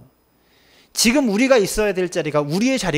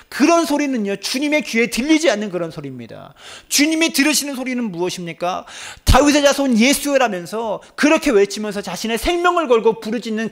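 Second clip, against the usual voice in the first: fiery preaching; 6 semitones higher than usual. Real, not acted. Pastor urging the congregation, full force. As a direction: preach it with fire.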